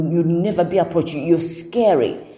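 ordinary talk, nothing marked

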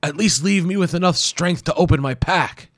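high strained plea; pained plea. Acted. Hero bargaining with warrior, high. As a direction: beg through pain. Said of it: "he said".

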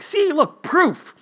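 high-pitched retold excitement, leaping up on the first words; excited. Onlookers showing the man he bled, excited. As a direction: crow it like triumphant proof. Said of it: "everybody else is just so excited".

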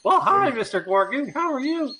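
put-on high innocent boy voice; little boy voice. Shown as a demonstration. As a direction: chirp it like a small boy. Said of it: "don't sound like there's a fucking problem".

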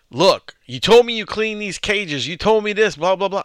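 high, loud mock scolding; retold yelling. Boss yells at new worker about cages, mock angry yell. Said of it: "I started yelling at him".

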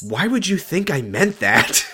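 slow, high mock disbelief; Amy. Amy answering Ashley's sex remark, slow exaggerated indignation. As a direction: imitate an appalled, drawn-out retort. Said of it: "another really good Shailene delivery".

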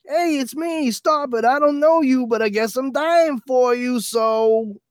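very high, loud squeaky hero parody; mock hero voice. Mocking the neighbor's imagined rescue, high squeaky parody. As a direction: squeal it like a silly would-be hero.

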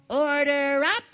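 loud cheerful call. Acted. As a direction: sing it out to the kitchen. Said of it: "cried SpongeBob".

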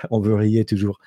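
light French accent, guttural R on riez; mock French accent. Shown as a demonstration. Showing a viewer how French speakers say riez, in a light French accent. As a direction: toss off a French line with a throaty R. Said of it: "that French accent".

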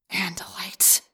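whispered with contempt; hissed whisper. A narrator's reading. Yeerk spotting the Andalite, hissed whisper. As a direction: hiss the name like a curse. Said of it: "the Yeerk hissed silently".

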